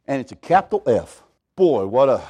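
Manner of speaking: ordinary talk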